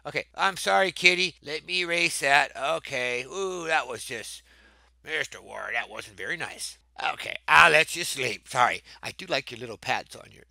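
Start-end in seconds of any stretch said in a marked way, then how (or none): 5.0-6.8 soft scolding. Real, not acted. Mild scolding of a classmate.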